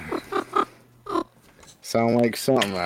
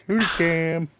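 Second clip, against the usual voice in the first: squealed quote; 12 semitones higher, 3 dB louder than usual. Retold or imitated. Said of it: "So I was like".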